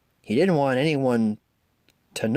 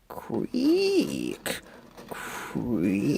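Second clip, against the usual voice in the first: eerie creak; 12 semitones higher, 4 dB quieter than usual. A narrator's reading. Monster answers from the dark.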